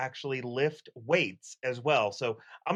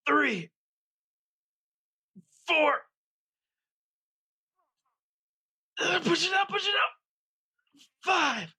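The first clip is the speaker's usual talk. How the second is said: mock lifting strain; 12 semitones higher, slower than usual. Gym guy shows gamers a mock workout, building to a straining shout. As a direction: ham up a huge weightlifting effort.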